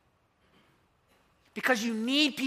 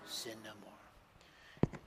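slow, breathy whispered blessing; tender whisper; 11 semitones lower, 17 dB quieter, much slower than usual. Retold or imitated. Preacher voicing Jesus to the forgiven woman, hushed and slow. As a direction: let it fall almost silent, very slow.

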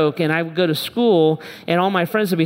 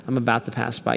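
softer, low line; 6 semitones lower, 5 dB quieter, faster than usual. Shown as a demonstration. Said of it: "the way we would say it".

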